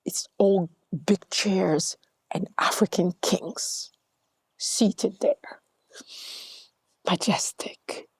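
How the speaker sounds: awed hush. Real, not acted. Awed description.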